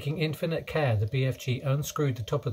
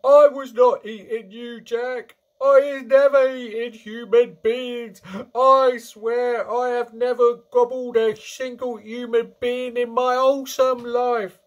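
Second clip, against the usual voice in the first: high, loud, desperate denial; screeching; 12 semitones higher, 9 dB louder than usual. A narrator's reading. Giant swearing to Jack he ate nobody, screeching. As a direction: screech your innocence at full voice. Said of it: "he screeched".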